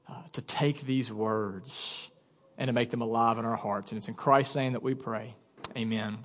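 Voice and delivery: low, soft, pausing prayer voice; congregational prayer. Real, not acted. Pastor asks God for help before the sermon, low quiet prayer. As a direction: pray quietly, well below preaching level.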